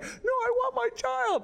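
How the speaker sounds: very high, slow wife's voice pleading; mock high-pitched wife; imitating wife. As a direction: mimic her clinging to the baby. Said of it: "Kimberly's like".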